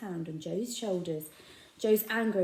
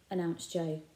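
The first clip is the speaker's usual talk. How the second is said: usual speaking voice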